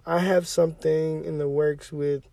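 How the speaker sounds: usual speaking voice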